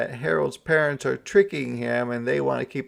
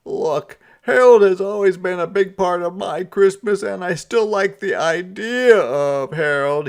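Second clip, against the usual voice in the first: high, loud put-on Santa voice; a sighing, higher Santa; 9 semitones higher, 10 dB louder than usual. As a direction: play him sulky and fed up.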